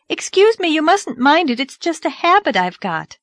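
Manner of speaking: high mocking imitation of him; mocking his excuse. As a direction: parrot his words scornfully. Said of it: "and saying".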